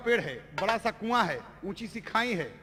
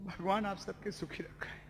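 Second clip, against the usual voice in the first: soft, hushed, almost whispered; a broken bride blessing them under her breath; quiet resigned plea; 4 semitones lower, 12 dB quieter than usual. Acted. Bound bride to her sister-in-law, hushed resigned blessing.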